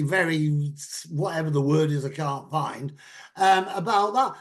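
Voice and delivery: normal talking voice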